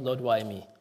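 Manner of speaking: low, soft, hushed plea to the Lord; the preacher softly voicing the cry we all make; quiet cry. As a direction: sink the voice down into a quiet, broken plea. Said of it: "We want to cry to the Lord".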